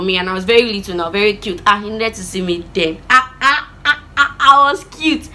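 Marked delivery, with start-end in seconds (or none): 3.1-5.3 playful boast. Pretend. Playfully boasting about little self.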